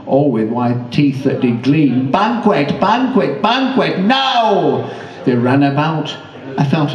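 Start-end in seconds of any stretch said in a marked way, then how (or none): 2.1-4.8 mock scream. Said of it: "did scream to several Chinese men".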